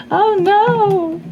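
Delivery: high squeal of dismay; dismayed surprise. Real, not acted. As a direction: yelp as the dreaded card appears. Bad tarot card turns up.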